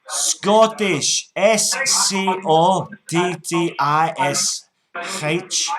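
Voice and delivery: put-on loud, slow, high Scottish accent; put-on Scots, drawn out and loud; broad Scottish accent. Prank caller to scammer, broad Scottish.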